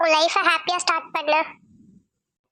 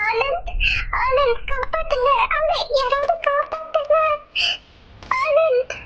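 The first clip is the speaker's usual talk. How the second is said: panicked cry; 6 semitones higher than usual. Acted. Grandmother watches grandson strangled.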